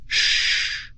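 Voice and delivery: sharp shush. A narrator's reading. Hushing sister near monster.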